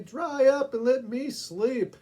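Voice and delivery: loud, high, slow order; exasperated shout. Acted. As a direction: tell her to shut up, loudly. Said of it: "sang out the man".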